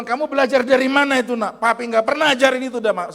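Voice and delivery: high, loud fatherly scolding, re-enacted; loud and forthright. Father asking his child who taught that, loud re-enacted scolding. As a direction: confront the child at full voice. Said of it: "Lantang kita ngomong".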